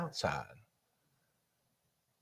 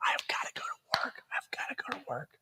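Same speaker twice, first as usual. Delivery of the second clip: urgent whisper under the breath; whispered to self; 3 semitones higher, 13 dB quieter than usual. Retold or imitated. Stuck at the pumpkin patch, whispering to himself impatiently. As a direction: mutter it so nobody hears.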